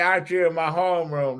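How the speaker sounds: normal talking voice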